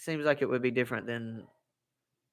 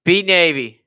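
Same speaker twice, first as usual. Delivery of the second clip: mock cheer; 6 semitones higher, 10 dB louder, slower than usual. Army cheer continues.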